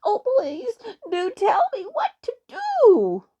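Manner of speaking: high, loud, drawn-out plea; pleading moan. A narrator's reading. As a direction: beg desperately in a little elf's voice. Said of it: "moaned Twinkling Feet".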